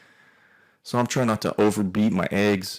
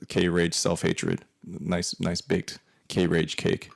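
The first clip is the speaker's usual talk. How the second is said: usual speaking voice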